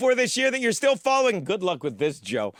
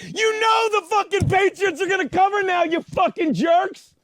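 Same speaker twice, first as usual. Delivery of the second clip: exasperated shouted outburst; angry yelling; 7 semitones higher than usual. Real, not acted. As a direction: yell at them, stretching the final insult. Berates crew who changed his script.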